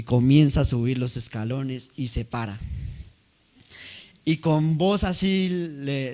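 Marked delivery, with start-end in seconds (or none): none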